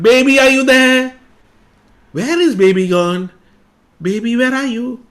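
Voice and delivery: high, loud calling; mother calling at the door, high and loud. Acted. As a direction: call for the missing child.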